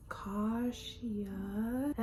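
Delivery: drawn-out questioning repeat of a name; puzzled echo. Retold or imitated. Classmate questioning her unfamiliar name, puzzled. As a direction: imitate a baffled classmate sounding it out.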